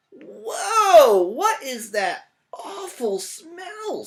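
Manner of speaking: high, loud, slow cow recoiling; disgusted cow. Acted. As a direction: drag out the cow's disgust. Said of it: "said the cow".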